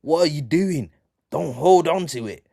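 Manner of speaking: urgent on-pitch call, acted; acted shout. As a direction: shout at a teammate mid-play.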